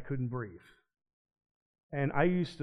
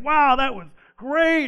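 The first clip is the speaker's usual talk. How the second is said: much higher and louder, slow and drawn out; amazed praise; 10 semitones higher, 11 dB louder than usual. Retold or imitated. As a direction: gush like a thrilled audience. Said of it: "everyone was like".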